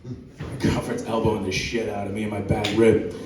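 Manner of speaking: ordinary delivery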